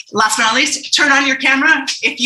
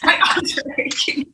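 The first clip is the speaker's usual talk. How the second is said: whispered echo; 5 semitones higher, 3 dB quieter than usual. Retold or imitated. Host echoes guest's line.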